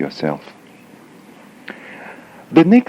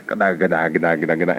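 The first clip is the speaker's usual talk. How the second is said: low, repetitive mock jabber; imitated nagging chatter; 5 semitones lower, 4 dB quieter, faster than usual. Imitating someone who always badmouths others, nagging jabber. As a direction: jabber nonsense syllables on a loop. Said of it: "always have bad things to say like this".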